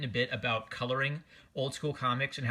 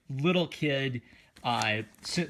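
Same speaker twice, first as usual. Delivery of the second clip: ordinary talk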